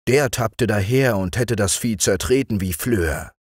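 ordinary delivery, nothing marked

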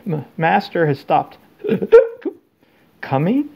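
high, hiccuping, tipsy elf voice; drunk elf, hiccuping. A narrator's reading. Winky drunkenly asking Harry about her master, hiccuping. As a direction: slur it between hiccups. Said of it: "She swayed slightly again and then said".

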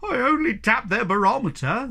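a frightened moan. A narrator's reading. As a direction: moan miserably from the pole. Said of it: "moaned the poor Hemulin".

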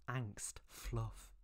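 ordinary delivery

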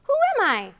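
ordinary delivery

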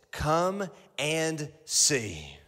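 very slow, drawn out "Come and see."; slow title. Shown as a demonstration. Preacher giving the sermon title, slow and deliberate. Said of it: "this is the title of your sermon".

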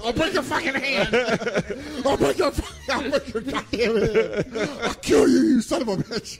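hammed-up threat through laughter; mock furious threat. Husband threatens the boss, hammed-up rage.

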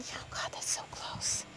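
whispered aside, tempted; hushed confession. Real, not acted. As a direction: breathe it like a guilty secret. Tempted by thirty-nine.

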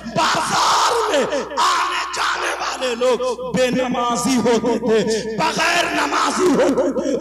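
forceful preaching. Real, not acted. Preacher explains the lost blessings to the crowd, forceful preaching. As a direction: drive each phrase home to the congregation.

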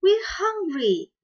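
high, slow, whiny little chick; small chick complaining to its mother; hungry hatchling. Acted. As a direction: pitch right up and drag it out like a hungry baby bird. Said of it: "replied the older hatchling".

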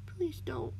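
high, weak whispered plea; groaning. A narrator's reading. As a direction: beg in a faint groan. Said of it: "the cat groaned".

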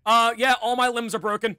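loud, high panicked yelling; man down shout. Real, not acted. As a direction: shout like you are bleeding out. Dying player yelling at medic.

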